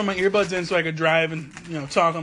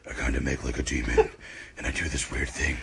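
very low demonic growl; 5 dB quieter than usual. Shown as a demonstration. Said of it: "this voice that I like to do".

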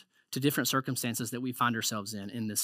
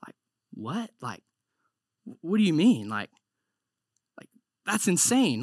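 stunned disbelief; 3 semitones higher than usual. Retold or imitated. Disbelief at God's answer.

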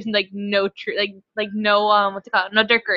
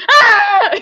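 mock scream; 11 semitones higher, 9 dB louder than usual. Mock monster scare.

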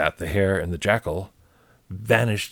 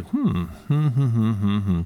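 thoughtful hmm. Acted. Hare pondering aloud.

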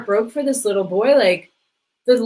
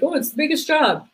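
a hint of mocking people, higher; mocking cliché; 8 semitones higher than usual. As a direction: lightly mimic a tired cliché. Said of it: "people are like".

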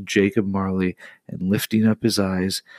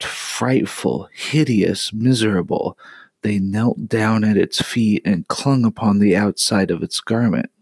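ordinary delivery, nothing marked

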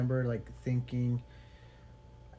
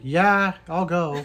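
mock eager; 4 semitones higher, 9 dB louder, much slower than usual. Mock eager agreeing to suggestion.

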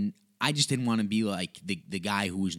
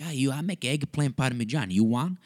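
high Italian grandmother voice; Nona; 6 semitones higher, 3 dB louder than usual. Acted. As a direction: offer food in Nona-style accented English, pitched up. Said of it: "she's like".

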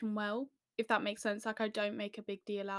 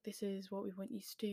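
hushed quick quote; 9 dB quieter, faster than usual. Retold or imitated. Quoting directors.

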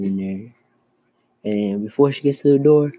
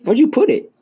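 high and loud, in mock surprise; teasing a friend over a missing joke, high and loud; mock surprised; 14 semitones higher, 7 dB louder than usual. Real, not acted. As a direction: shoot the voice way up, playacting a search.

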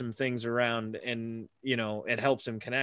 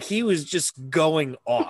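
usual speaking voice

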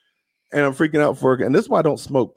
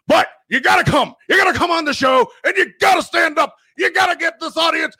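much higher, shouted conspiracy-host rant; Alex Jones rant. Pretend. Invites Alex Jones onto the show, shouting way up high. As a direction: bellow it like a fired-up radio host. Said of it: "do a bit in Alex Jones' voice".

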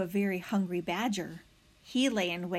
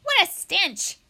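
much higher, louder cry of disgust; disgusted badger; 6 semitones higher, 5 dB louder, slower than usual. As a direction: recoil from the stink as a badger. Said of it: "badger's face twisted with disgust".

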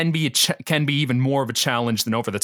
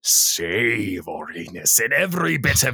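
slow savoury villain voice; Robotnik voice; slower than usual. Acted. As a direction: draw out every word like a cartoon villain. Performs Robotnik-style narration voice.

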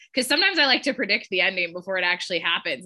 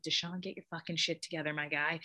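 soft, tense mock plea under the breath; quietly imitating a stressed friend; urging under the breath, not a yell; hushed urging; 3 semitones lower, 10 dB quieter than usual. Danny freaking out on jury.

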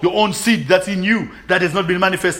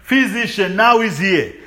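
ordinary talk

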